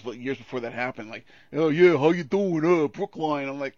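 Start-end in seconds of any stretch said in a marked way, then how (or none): 1.5-3.5 loud, higher-pitched mock Brooklyn accent; exaggerated Brooklyn Italian-American teasing; mock Brooklyn mobster. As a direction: ham it up like a Sopranos wiseguy. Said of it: "like I was in The Sopranos".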